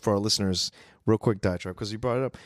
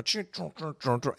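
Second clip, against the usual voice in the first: chugging mechanical sound imitation; mouthed robot transforming effect; Transformer transforming noises; 2 semitones higher, 6 dB quieter than usual. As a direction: imitate a robot shifting shape, chunk by chunk. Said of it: "we're making the noises of transforming into Transformers".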